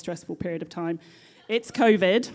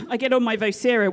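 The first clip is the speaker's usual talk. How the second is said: normal talking voice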